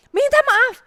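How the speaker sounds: high, loud command; loud stern mother; stern order. Retold or imitated. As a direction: order the apology.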